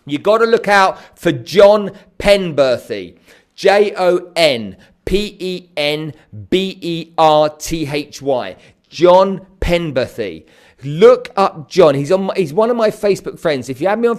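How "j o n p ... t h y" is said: very slow, letter by letter; slow spelling out. Real, not acted. Coach spelling a name for viewers, slow and deliberate.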